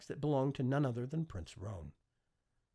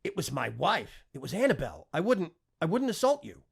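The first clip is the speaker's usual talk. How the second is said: rising panic, louder and higher to the end; frantic pleading; 5 semitones higher, 6 dB louder than usual. A narrator's reading. Terrified lord pleading with the prince, frantic. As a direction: babble the excuse in a panic.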